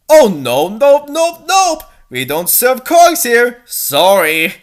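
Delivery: high, loud bunny owner voice; a fussy cafe owner shooing them off; shopkeeper refusing. Acted. Cafe owner turning away a cog, high and loud.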